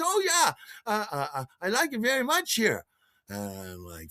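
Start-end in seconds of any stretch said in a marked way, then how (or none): 0.0-2.8 put-on high-pitched German-accented woman; Lisbeth's German accent. German woman asked whether she likes it here, high accented voice. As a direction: imitate her eager, high German-accented reply. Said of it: "did I detect an accent".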